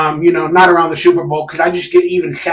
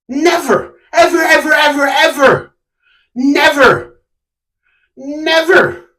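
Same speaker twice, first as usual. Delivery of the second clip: higher, drawn-out repeated never, ever; insistent repetition, pitched up; emphatic never; 7 semitones higher, slower than usual. Real, not acted. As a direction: hammer each never home. Emphatic refusal.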